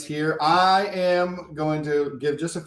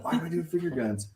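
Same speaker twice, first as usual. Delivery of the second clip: normal talking voice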